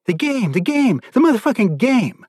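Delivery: high, chanting taunt of an abuser; sing-song cruel repetition; taunting captor. Retold or imitated. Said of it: "He just kept saying".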